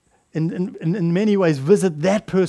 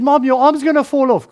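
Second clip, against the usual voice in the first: put-on alarmed child; 7 semitones higher, 5 dB louder than usual. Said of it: "He said".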